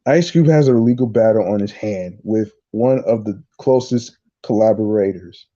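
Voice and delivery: ordinary talk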